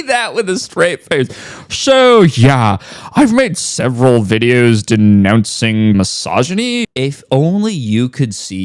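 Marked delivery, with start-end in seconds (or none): 1.7-6.8 loud opening, then low mock-pompous delivery; mocking imitation. Critic repeats the creator's boast back at him, mocking. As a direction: parody his self-important claim in a lowered voice.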